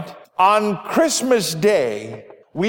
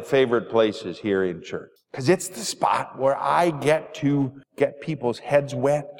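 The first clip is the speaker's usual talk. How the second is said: usual speaking voice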